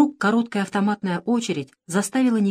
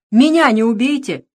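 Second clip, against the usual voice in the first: loud urgent call out; 4 semitones higher, 7 dB louder than usual. A narrator's reading. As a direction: shout so they don't shoot. Calling out to own men.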